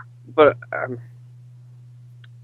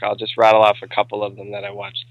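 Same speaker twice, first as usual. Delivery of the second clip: ordinary talk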